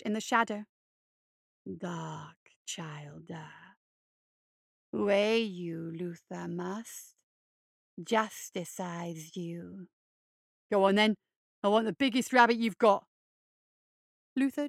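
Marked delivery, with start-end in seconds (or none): 1.6-3.8 slow, breathy monster whisper; low creeping whisper; menacing whisper. Acted. Luther looms over boy. 4.9-9.9 low, slow, creepy hare voice; low creeping monster croon; hulking hare creature. Acted. Luther judges the boy. 10.7-13.1 loud, cocky demand; brash shout; defiant boy. Acted. Eddie defies the monster.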